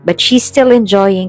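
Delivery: ordinary delivery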